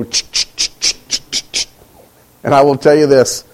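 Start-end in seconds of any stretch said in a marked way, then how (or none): 0.0-1.7 soft breathy ch ch sounds mimicking a comb; combing noise. Dad combing his son's hair, soft hissed ch sounds. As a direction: hiss the comb strokes under your breath. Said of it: "grab my hair and go".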